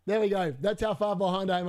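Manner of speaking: ordinary talk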